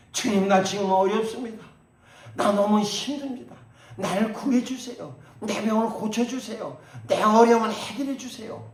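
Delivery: loud, high mock begging prayer; imitating a congregant crying out for help; pleading prayer. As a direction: ham up a desperate believer's plea. Imitated needy prayer of believers.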